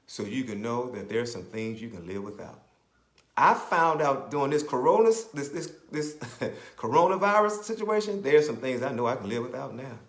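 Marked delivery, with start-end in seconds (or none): 3.4-10.0 loud, rising preacher's cry; fervent preaching. Acted. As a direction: lift the congregation with it. Preaching at full force.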